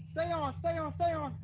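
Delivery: high urgent pleading repetition. Real, not acted. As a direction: beg the fish to stay on. Fighting fish, urging it stay.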